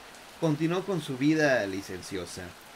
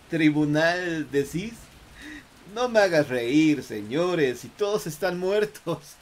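mocking; 5 semitones higher, 6 dB louder, slower than usual. Arrogant man mocks soldiers.

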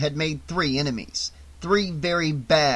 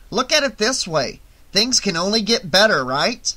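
high, raised, cheerful voice; happy, upbeat; 5 semitones higher, 4 dB louder than usual. A narrator's reading. Shelley too happy to be upset, cheering Vera up. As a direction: reassure her with irrepressible optimism. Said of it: "Shelley was still too happy to be upset".

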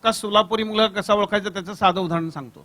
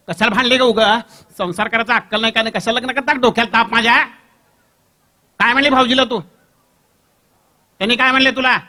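scolding; 3 semitones higher, 6 dB louder than usual. Acted. Brother scolds sister on phone.

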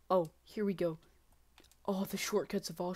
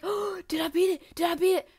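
high, loud, overexcited self-imitation; mock excitement; 9 semitones higher, 10 dB louder than usual. Player mocks their own false hope, hammed-up excitement. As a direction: imitate yourself getting carried away. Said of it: "I got so excited".

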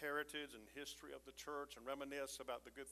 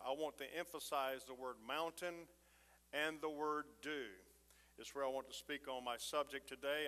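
usual speaking voice

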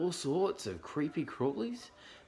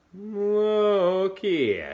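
loud, high, stretched yawn on the words; yawning koala; 10 semitones higher, 9 dB louder than usual. A narrator's reading. Koala climbs down and speaks up, yawning. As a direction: yawn right through the words. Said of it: "he yawned".

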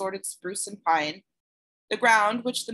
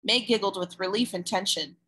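plain reading voice

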